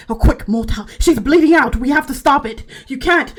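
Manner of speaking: voiced attendants in a rush. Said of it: "You hear voices".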